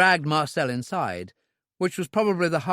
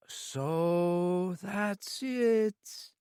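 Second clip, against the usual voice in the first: slow, higher voiced club owner with relief leaking through; relieved villain; 6 semitones higher, 4 dB quieter, much slower than usual. A narrator's reading. Owner thinks it's only a robbery, slow relieved voice. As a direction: drag it out, hiding relief badly. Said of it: "good with his face, but not so good with his voice".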